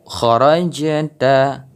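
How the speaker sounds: very slow "kharajta" with the j bouncing; qalqalah on jim. Shown as a demonstration. Teacher demonstrating qalqalah, slow bounced jim. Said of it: "kita dengar suara pantulan".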